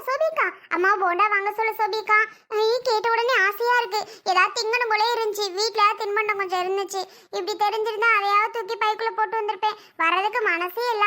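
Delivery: normal talking voice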